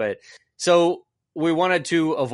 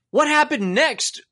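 usual speaking voice

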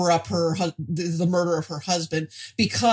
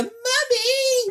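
put-on high wailing child cry; child crying Mommy. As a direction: shriek it like a kid. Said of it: "she's a real".

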